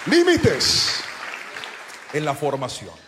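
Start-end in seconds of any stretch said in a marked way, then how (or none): 0.1-1.0 one high, loud drawn word; called-out headline. Real, not acted. Preacher announcing the sermon's point to the church, called out loud. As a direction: proclaim it to the whole hall.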